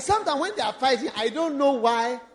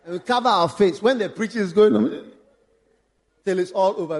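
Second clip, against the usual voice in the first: ordinary talk, nothing marked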